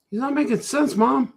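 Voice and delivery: put-on high complaining child; kid's whiny voice. A child complaining about the artist, high whine. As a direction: whine it like a kid to his mom. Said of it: "That's what people say".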